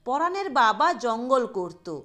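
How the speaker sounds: ordinary delivery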